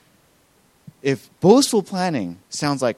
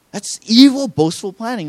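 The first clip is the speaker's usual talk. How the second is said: sudden loud mock outrage, much higher; mock horror; 8 semitones higher, 8 dB louder than usual. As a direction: gasp out the word evil in fake shock. Mock outrage at normal plans.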